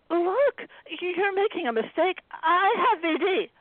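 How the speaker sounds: high, pleading, a little slow; frightened pleading. Acted. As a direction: plead for your life. Anna pleading at gunpoint.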